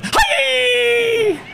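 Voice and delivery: shrieked very high and loud; sudden high shout. Retold or imitated. Seatmate shrieking on the plane so stewardesses come running. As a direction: yelp it out high and loud in alarm. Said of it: "she goes".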